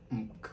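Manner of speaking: "nk" said as a nasal n plus k; sound nk. Shown as a demonstration. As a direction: say "nk" with the tongue back, ending in a crisp k. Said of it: "nous prononçons comme".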